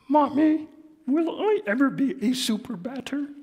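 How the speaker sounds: high hopeful puppet voice; Grover voice. Acted. Grover asking his mother, high hopeful voice. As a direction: ask Mommy hopefully. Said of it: "letting me do the voices".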